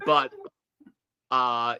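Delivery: normal talking voice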